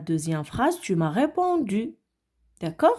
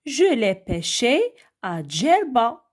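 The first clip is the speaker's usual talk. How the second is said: reading an example aloud; 3 semitones higher than usual. Shown as a demonstration. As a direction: read the answer sentence slowly. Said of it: "regardez avec moi la deuxième phrase".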